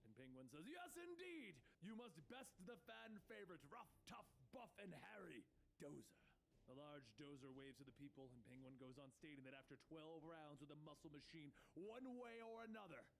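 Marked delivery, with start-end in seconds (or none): 0.7-6.2 high, excited showman villain; Penguin. A narrator's reading. 11.7-13.1 ringmaster's flourish; Penguin. A narrator's reading.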